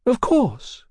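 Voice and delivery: a lady obliging a fan; lady's voice. Acted.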